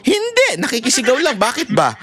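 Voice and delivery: high, innocent mock answer; high silly reply. Busybody admitting he only joins the shouting, high and silly. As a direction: squeak out the excuse like a busybody.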